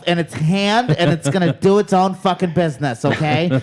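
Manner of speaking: loud, high, sassy protective voice; sassy mother hen. Acted. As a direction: defend your friend like a sassy mother hen. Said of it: "Whoa, he does do voices".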